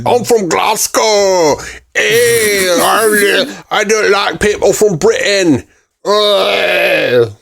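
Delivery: put-on a deliberately terrible Glasgow accent; playing a grumpy Scot badly; bad Scottish accent. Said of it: "the worst impersonation I could possibly do".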